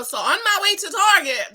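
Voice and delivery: ordinary talk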